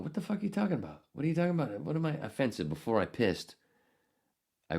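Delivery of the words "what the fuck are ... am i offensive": indignant retort. Retold or imitated. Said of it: "As I was going".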